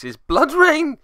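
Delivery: high exclamation of dismay; dismayed cry. Real, not acted. Player watching enemy trigger Blood Rain, crying out high in dismay. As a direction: shoot the voice up in alarm.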